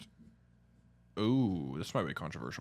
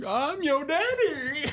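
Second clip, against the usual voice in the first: much higher, slow, laughing goofy voice; Waterboy impression; 6 dB louder, slower than usual. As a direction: drawl it out like the dopey coach. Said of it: "where he's like".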